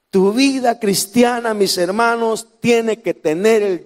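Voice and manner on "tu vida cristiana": usual speaking voice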